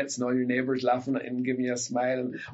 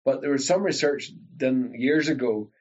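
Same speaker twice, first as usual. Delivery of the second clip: ordinary delivery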